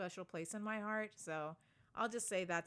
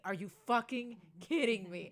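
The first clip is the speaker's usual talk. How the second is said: louder, exasperated repeat; louder disbelief; 5 dB louder than usual. Retold or imitated. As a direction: let it burst out louder. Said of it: "I'm like".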